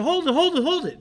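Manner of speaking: high, loud call to stop him. Retold or imitated. Homeowner running out calling after the driver, loud. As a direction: shout hold it with a laugh in it. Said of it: "He said".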